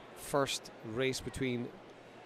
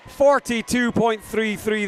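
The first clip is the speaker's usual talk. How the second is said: high, loud, thrilled announcement of the time; excited time call; 8 semitones higher, 10 dB louder than usual. Real, not acted. Commentator thrilled by a fast finish time, excited and loud. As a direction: shout the time out with delight.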